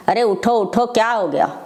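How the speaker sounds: ordinary delivery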